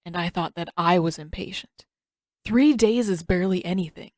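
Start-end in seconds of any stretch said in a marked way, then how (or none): none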